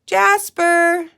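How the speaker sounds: put-on girl searching for her missing dog, calling out. A narrator's reading. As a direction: call loudly for the lost dog. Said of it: "she called".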